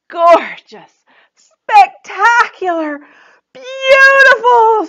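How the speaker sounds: Joy amazed by the finished dress; marvelling. Acted. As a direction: stretch each word in awe.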